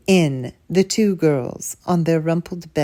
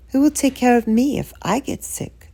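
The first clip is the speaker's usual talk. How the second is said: put-on worried little girl; 7 semitones higher than usual.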